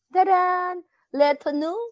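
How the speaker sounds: high, loud Taran then Leto nu with a rising lilt; posh French flourish. Pretend. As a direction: show off Leto nu like haughty French. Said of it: "Nakakahaba ng liig ang pronunciation".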